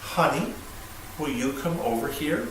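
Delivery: soft, unhurried ask to a spouse; careful, gentle request. Shown as a demonstration. Husband calling his wife over with care, soft and gentle. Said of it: "we have to be very careful".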